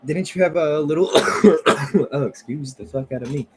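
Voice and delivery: normal talking voice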